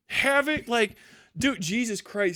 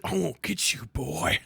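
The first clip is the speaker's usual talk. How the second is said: imitating the villain; 4 semitones lower, 6 dB quieter than usual. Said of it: "The way he says".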